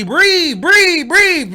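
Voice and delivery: loud, high parroted orders; mocking imitation. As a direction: throw the coach's words back mockingly. Mocks friend's coaching.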